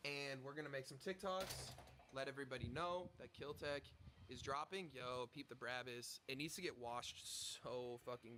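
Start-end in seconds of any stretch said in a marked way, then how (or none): none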